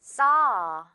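saw said slowly with an open ah; model saw. Shown as a demonstration. Teacher modeling saw for students to repeat. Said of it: "One more time".

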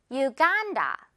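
"유간다" as yoo-GAAN-da; model pronunciation. Shown as a demonstration. Teacher has learners listen and repeat, "유간다" as yoo-GAAN-da. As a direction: stretch and stress 간 in "유간다" after a clear "yoo". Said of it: "듣고 따라해 주세요".

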